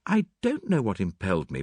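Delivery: plain reading voice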